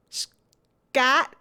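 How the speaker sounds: loud, high, drawn-out "Scott" as "Scat"; name with an A. Pretend. Gamer savouring the character's name, "Scott" with an A. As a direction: hold the S, then hit the A in "Scott". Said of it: "Yeah, that's his name".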